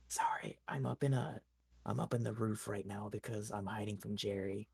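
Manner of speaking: whispering from the roof while hiding. Retold or imitated. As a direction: keep it hushed so Jerry won't hear. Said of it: "I'm, like, whispering".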